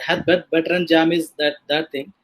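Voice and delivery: ordinary delivery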